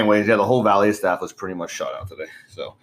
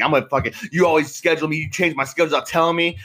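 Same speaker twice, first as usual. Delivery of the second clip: higher, pumped-up mock rant at the boss; picking a fight in his head, a little higher; imagined confrontation; 7 semitones higher than usual. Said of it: "I'm like".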